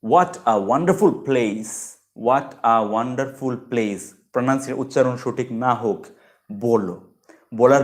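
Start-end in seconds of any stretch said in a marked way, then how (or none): 0.0-4.0 very slow, word-by-word model phrase; slow practice repetition of an admiring phrase; repeat-after practice. Shown as a demonstration. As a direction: draw it out for learners to echo. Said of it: "সাথে সাথে বলো".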